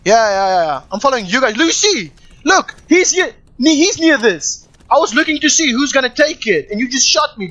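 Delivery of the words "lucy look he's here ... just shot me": raised voice calling out; yelling across the room. Real, not acted. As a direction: shout it to teammates, urgent. Calling teammates in game.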